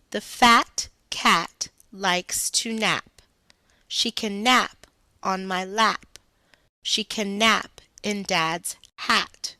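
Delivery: slow sing-song reading, stressing each short a; verse read to children. Shown as a demonstration. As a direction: read it slowly to the class, leaning on the vowels. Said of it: "Here is the verse".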